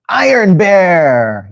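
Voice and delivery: high, long-drawn superhero reveal; dramatic hero announcement. Acted. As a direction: stretch both words out like a grand entrance. Announcing the surprise hero's entrance.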